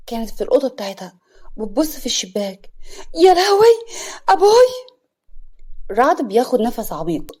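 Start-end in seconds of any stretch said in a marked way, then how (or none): 3.2-5.0 high, loud shriek of alarm; acted panic, voice leaping up high; panicked cry. A narrator's reading. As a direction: wail out in sudden dread. Layla panics seeing her father.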